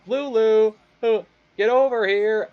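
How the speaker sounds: high, loud put-on owner's call; calling a dog. Imagined owner calling Lulu over, loud high call.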